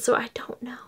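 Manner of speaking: soft unvoiced whisper. Real, not acted. Vlogger admitting to viewers she's lost, whispering. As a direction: trail off into a bewildered whisper.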